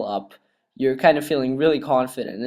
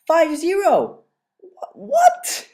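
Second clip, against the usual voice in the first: "What?" cried out much higher; stunned disbelief; much slower than usual. Real, not acted. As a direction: react like you can't believe the score. Disbelief at the 5-0 score.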